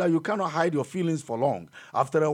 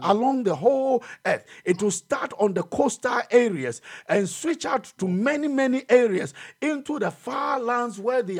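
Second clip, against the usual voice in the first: raised, high prophetic preaching; fiery preaching; 3 semitones higher than usual. Acted. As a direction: proclaim the coming storm. Prophetic preaching at full force.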